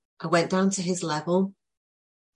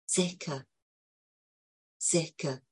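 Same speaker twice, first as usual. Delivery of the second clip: soothing reassurance; 2 semitones lower, 8 dB quieter, slower than usual. Retold or imitated. Soothing a distressed child.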